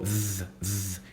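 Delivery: soft low buzzing zz; quiet z drill; buzzing z sound. Shown as a demonstration. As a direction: hold a quiet z buzz. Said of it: "vai parecer um Z".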